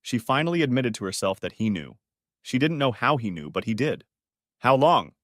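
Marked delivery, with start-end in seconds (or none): 4.6-5.1 louder, sharp demand; called out. Acted. Husband demanding an answer from wife, loud call. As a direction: call it out hard across the table. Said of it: "he called out".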